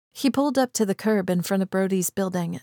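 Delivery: ordinary delivery, nothing marked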